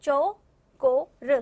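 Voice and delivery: very slow, word split into separate sounds; drawn-out syllables. Shown as a demonstration. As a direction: sound out चौकोर piece by piece for learners. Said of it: "सुना आपने?".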